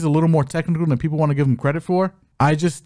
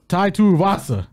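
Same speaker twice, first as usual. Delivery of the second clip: put-on Tai Tuivasa boomed in a made-up accent, a touch higher; odd mock accent; 4 semitones higher than usual. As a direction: call the name like from a mountaintop. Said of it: "like you were saying it from a mountain".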